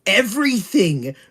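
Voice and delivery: loud, high, slowing exasperated scolding; exasperated rant. Real, not acted. Fed-up fan scolding Rudius for defending slavery, loud and exasperated. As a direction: spell it out to an idiot, word by word.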